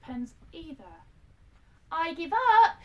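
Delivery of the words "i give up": much higher, loud frustrated shout; a girl giving up on hide and seek, shouting. Acted. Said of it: "she shouted".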